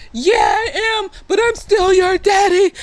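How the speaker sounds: high mock-crying confession; tearful defiance. Montrose admits the truth to his son, tearfully defiant. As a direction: wail it, hammed up.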